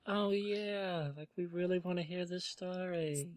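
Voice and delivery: quietly imitating the group's eager reaction; hushed, drawn-out mock eagerness; imitating eager listeners. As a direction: play back their keenness, softly. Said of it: "I like how we, everyone was like".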